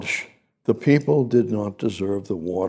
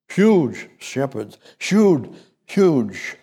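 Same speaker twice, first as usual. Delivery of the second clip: huge stretched slow then hit louder and higher; stress on huge; 4 semitones higher, 4 dB louder, much slower than usual. Real, not acted. Preacher to congregation, punching huge. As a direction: hammer the word huge each time, louder. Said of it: "repeats huge for emphasis".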